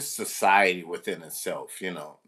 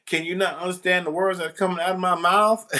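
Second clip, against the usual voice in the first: much higher, exasperated movie quote; Chris Tucker line; 10 semitones higher, 3 dB louder, faster than usual. Retold or imitated. Sub teacher quoting Chris Tucker at a clowning student, high and exasperated. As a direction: pitch it way up like the Rush Hour line. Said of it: "what Chris Tucker said in that".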